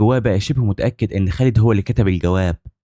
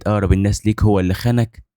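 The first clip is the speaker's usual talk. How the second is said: usual speaking voice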